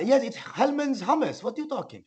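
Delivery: high, stretched put-on Iranian accent; mock Iranian accent. Friend defends his mayonnaise hummus, high mock Iranian accent. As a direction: protest in a fake Iranian accent. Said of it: "got back three percent Iranian".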